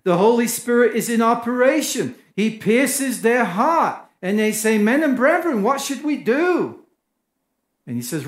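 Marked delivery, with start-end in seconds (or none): 4.8-6.8 higher, anxious plea from the crowd; pleading crowd. Acted. Pierced crowd asks Peter what to do, pleading. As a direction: ask for help, cut to the heart.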